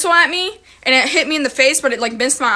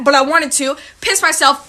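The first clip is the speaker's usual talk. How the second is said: normal talking voice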